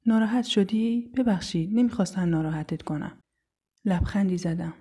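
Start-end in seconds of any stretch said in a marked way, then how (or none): none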